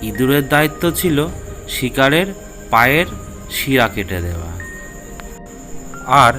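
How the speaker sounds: usual speaking voice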